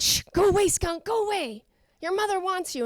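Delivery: high-pitched shooing of an animal; urgent little shoo, acted out; shooing away. Shooing a skunk away mid-story.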